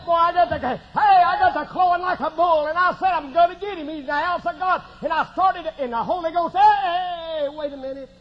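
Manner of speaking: high, urgent pulpit storytelling; fired-up preaching. Real, not acted. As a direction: preach it excitedly, words tumbling out high. Preaching at full force.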